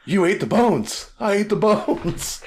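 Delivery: mock the silly chicken ad, stretched out; ad impression. KFC ad actors about boneless chicken, high hammed-up voices. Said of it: "He's like".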